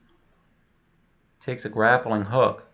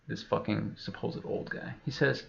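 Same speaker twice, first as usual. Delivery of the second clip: ordinary talk, nothing marked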